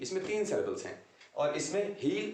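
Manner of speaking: usual speaking voice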